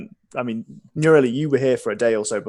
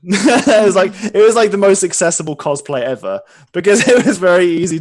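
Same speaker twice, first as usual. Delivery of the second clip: laughing; 8 semitones higher, 11 dB louder than usual. Real, not acted. Laughing while telling.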